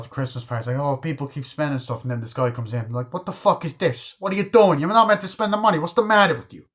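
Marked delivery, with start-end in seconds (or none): 3.1-6.7 shouting high and loud in mock fury; hammed-up gangster tirade; angry yell. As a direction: yell at them like a furious gangster. Retelling Pesci yelling at Christmas party.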